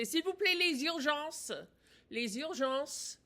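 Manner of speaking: slow, careful French: "S'il vous plaît, les urgences"; teacher's slow French demo; French phrase. Shown as a demonstration. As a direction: model the French slowly and clearly for learners. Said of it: "you say".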